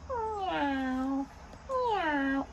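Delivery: soft, drawn-out meow with a falling glide; cat meow. Shown as a demonstration. Showing a child what a cat says, soft meow. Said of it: "What does a cat say?".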